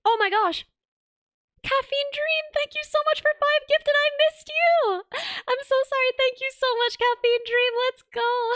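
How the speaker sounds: high-pitched excited thanks; excited squeal. Real, not acted. Excited thanks for gifted subs.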